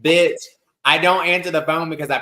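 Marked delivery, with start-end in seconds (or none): none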